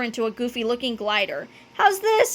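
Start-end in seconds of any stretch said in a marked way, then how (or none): 1.7-2.3 high, urgent question; frantic kid without much volume; mild scream. A narrator's reading. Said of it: "he screamed".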